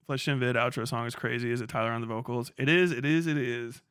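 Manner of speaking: normal talking voice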